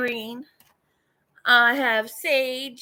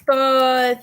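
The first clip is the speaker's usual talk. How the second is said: ordinary talk, nothing marked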